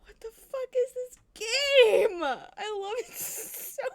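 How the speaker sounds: loud, slow teary laughter; real tearful laughter of delight; laughing to tears. Real, not acted. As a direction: laugh so hard the voice cracks.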